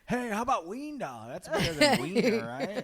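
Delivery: high, laughing, eager sell; lightly voiced hopeful kid; pitching a nickname. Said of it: "I tried to get that nickname going".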